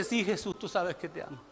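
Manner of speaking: soft, low, humbled reply; subdued acted confession of love; meek Peter. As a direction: answer quietly, voice dropping away.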